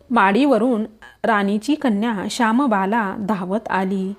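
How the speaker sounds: ordinary delivery, nothing marked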